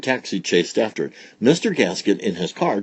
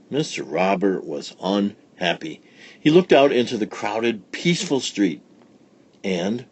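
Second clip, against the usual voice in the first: usual speaking voice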